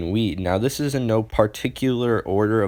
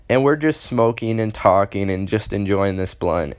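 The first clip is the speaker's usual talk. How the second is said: usual speaking voice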